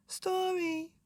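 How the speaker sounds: put-on high soft baby word; tiny child asking; high small baby voice.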